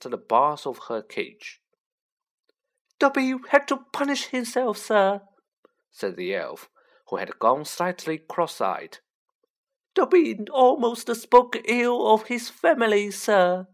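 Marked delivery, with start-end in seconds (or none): 3.0-5.3 high, squeaky elf voice; high-pitched Dobby. A narrator's reading. Elf explaining his self-punishment, high-pitched. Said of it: "in a high-pitched voice". 9.9-13.7 high, loud elf squeak; high-pitched Dobby. A narrator's reading. Elf confessing to Harry, high-pitched and loud. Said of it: "in a high-pitched voice".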